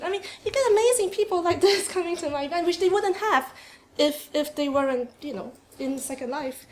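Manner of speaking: normal talking voice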